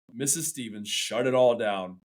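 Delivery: ordinary delivery, nothing marked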